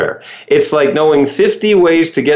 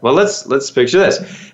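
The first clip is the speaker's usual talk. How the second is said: ordinary delivery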